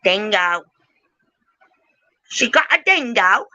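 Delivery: high-pitched, badly faked Australian; attempted Australian accent. Host practising an Aussie line about a dingo, high mock Australian. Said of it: "I can't do Australian accent either".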